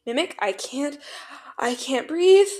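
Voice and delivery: struggling to breathe, voice pushed up; panicked. A narrator's reading. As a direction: plead as if you can't get air. Panicking character gasping for breath.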